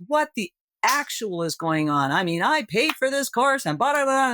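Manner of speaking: mock-outraged complaint about the course; indignant outburst. Acted frustrated first reaction.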